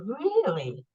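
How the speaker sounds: high, drawn-out surprised really; mock surprise. Telling a guest how she reacted to Spirit, mock surprise. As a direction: play up the surprise at the news.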